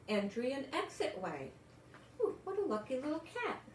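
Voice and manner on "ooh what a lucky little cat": higher, quick gushing coo; an excited little gasp of delight; delighted aside. Acted. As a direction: gush over the lucky cat to the kids. Reader's delighted aside about Mog.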